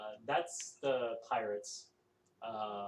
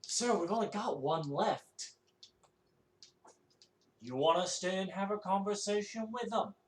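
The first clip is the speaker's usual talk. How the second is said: frustrated retort; 9 semitones higher, 4 dB louder than usual. Acted. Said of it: "looks back at him frustrated".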